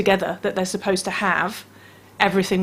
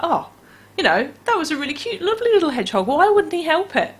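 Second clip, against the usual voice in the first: put-on child's voice; 8 semitones higher, faster than usual. Voicing a child reacting.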